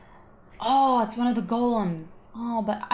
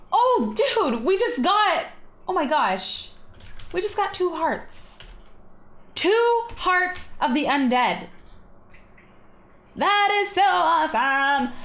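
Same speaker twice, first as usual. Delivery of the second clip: excited; 9 semitones higher than usual. Real, not acted. Thrilled by two heart drops.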